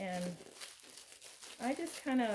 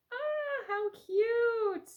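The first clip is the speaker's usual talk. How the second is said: much higher, louder gush of delight; delighted squeal; 13 semitones higher, 5 dB louder, much slower than usual. Real, not acted. Thanking Kelly for the coasters, delighted high squeal. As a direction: coo over the gift like it melts you.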